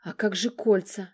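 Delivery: ordinary delivery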